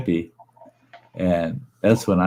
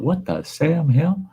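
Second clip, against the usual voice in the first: slow, higher retold exclamation; baffled exclamation; 7 semitones higher than usual. Man retells hearing the mower fail, baffled. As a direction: draw it out in mock bafflement.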